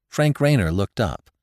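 ordinary delivery

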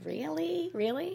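high, disbelieving really; incredulous. Retold or imitated. Reacting to the rude friend, high and incredulous. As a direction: scoff in disbelief.

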